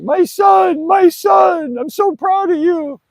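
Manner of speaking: exaggerated crying old man, wailing loudly; imitating his drunk dad crying. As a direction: ham up the sobbing drunk father gushing with pride. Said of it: "and he was crying, like".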